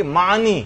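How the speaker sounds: معنی ending in a long i, "maa-ni"; common mispronunciation. Shown as a demonstration. Teacher quoting how people read it, معنی said as "maa-ni". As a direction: finish معنی on an "ee" sound. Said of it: "لوگ پڑھتے ہیں کہ".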